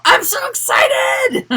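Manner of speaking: high, loud, drawn-out squeal of excitement; mock excitement. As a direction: shout it like an over-the-top thrilled performer. Mock cheer, what nobody says on stage.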